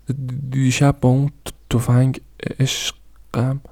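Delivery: halting stutter, broken and very slow on the last word; stammering. A narrator's reading. Daughter stammers telling her father about the gun, halting stutter.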